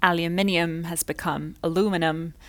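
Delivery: aluminium said British, then aluminum said Canadian with four syllables. Shown as a demonstration. Teacher contrasting British aluminium with Canadian aluminum. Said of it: "my pronunciation of words like".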